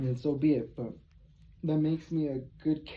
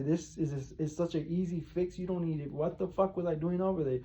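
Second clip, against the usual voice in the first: normal talking voice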